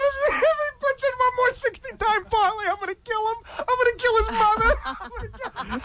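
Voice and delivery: laughing through the quote. Retold or imitated. Retelling threat, laughing throughout.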